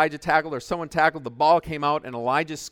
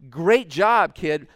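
mild shout; 4 semitones higher, 8 dB louder, much slower than usual. Retold or imitated. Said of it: "shouting out".

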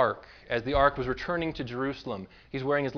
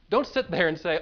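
ordinary talk

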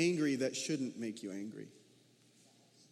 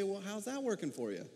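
Doctor Phil; 4 dB quieter, faster than usual. Retold or imitated. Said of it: "And Doctor Phil would say".

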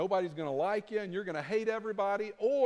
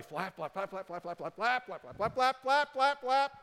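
frantic flapping; 6 semitones higher than usual. Acted. Preacher mimicking non-stop weekday striving, frantic and building.

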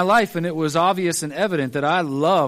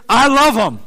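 "I love him" with LOVE said louder and higher; emphatic loud declaration; 4 semitones higher, 7 dB louder than usual. Acted. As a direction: proclaim it out loud, full of conviction. Preacher repeats, voice swells.

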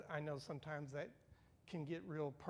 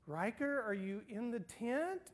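higher, lilting seeker's call; grandma searching, sing-song and higher; playful calling; 7 semitones higher, 5 dB louder than usual. Retold or imitated. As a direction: sing out the question like a game. Grandma calling hiding child.

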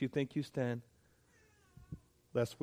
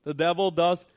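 fervent preaching; 3 semitones higher, 5 dB louder than usual. Acted. Preaching rises in force.

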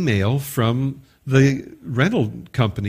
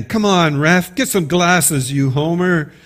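louder, higher yelling at the ref; heckling sports fan; 4 semitones higher, 4 dB louder than usual. Acted. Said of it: "And then a man".

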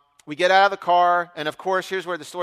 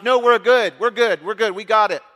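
hasty refusal; 3 semitones higher, 8 dB louder than usual. Retold or imitated. Waves off another gas seeker.

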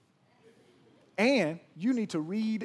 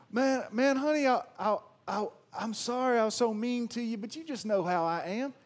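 higher, whiny fake apology; mock a husband brushing it off; mocking mean husband; 6 semitones higher than usual. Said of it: "That's not an apology".

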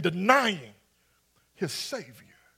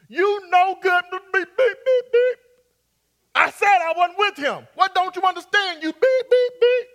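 furious shout, much higher and louder, bleeped curses; angry outburst; 8 semitones higher, 7 dB louder than usual. Acted. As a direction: blow up at them, cussing them out with beeps. Peter's angry denial acted out.

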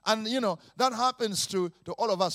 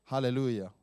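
ordinary talk

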